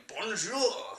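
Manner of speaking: high, exaggerated French greeting; hammed-up French accent; mock French. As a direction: sing out BONJOUR like a cartoon French vlogger. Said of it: "Same way where if I was in".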